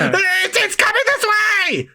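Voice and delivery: very high panicked squeal; terrified shriek. Acted. Villain as the train heads right for him, terrified squeal. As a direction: squeal in terror.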